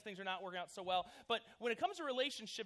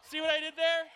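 pleased with own joke; 7 semitones higher, 12 dB louder than usual. Real, not acted. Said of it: "I'm funny".

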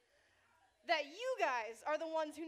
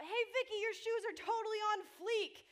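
yelling; 8 semitones higher, 7 dB louder than usual. Retold or imitated. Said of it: "I yelled out".

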